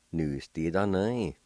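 "Nu. S. Di. Tho. Nu. I." run together as one word; blended word demo. Shown as a demonstration. Teacher pronouncing word number two for learners, quickly joined up. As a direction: join all the syllables without pauses. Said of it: "now I'll pronounce these words".

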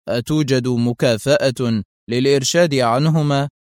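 usual speaking voice

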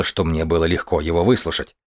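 ordinary delivery

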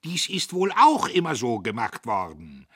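high, loud sarcastic tirade; scolding at full voice; loud railing. A narrator's reading. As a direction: rant at the bookkeeper in mock congratulation. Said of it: "Er zeterte".

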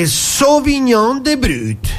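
high, very slow punchline; grand finale. Acted. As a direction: stretch out the final flourish. Punchline of the poem. (finale lentissimo e acuto)

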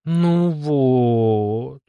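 drawn-out, pouting whine; sulky pout. A narrator's reading. Dolce let down by the cancelled music, pouting. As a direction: pout and stretch it out. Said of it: "надула губки Дольче".

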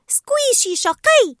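high, slow, loud pouting voice; Dory, childish scolding. Acted. Dory telling off the jellyfish that stung her, pouting. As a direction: scold it like a naughty pet.

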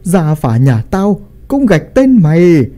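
put-on old father disowning his son, pitch soaring by the end; angry denunciation. A narrator's reading. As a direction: narrator acting a wrathful banishment, building to a high cry. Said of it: "chỉ tay vào thằng con trai mình mà nói".

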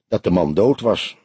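plain reading voice

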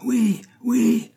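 very high, strained mob cry; howling crowd. A narrator's reading. Crowd backs the sorcerer's accusation, howling. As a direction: imitate many voices howling assent. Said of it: "hurlèrent des voix".

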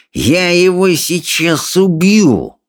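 slow, high, drawn-out threat; seething bully's snarl; menacing hiss. A narrator's reading. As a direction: stretch out the threat. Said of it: "прошипел Сопляк, грозно надвигаясь".

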